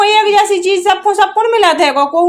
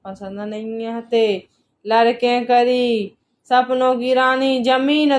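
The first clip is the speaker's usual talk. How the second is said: slow, low, drawn-out words; mocking recital; 5 semitones lower, 6 dB quieter, much slower than usual. Wife recites husband's dream-queen complaint back, slow mocking voice. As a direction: drag it out low and soft, mimicking a complaint.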